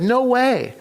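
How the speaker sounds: high-pitched retold refusal; emphatic refusal. Wife turns down getting back together, emphatic. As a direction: refuse outright, pitch jumping up.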